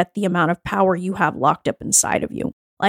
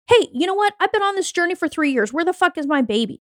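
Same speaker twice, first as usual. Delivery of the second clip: fast, higher mock entitled outburst; mock entitled demand; 7 semitones higher, faster than usual. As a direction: demand it like a customer who's owed. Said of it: "feed a sense of entitlement".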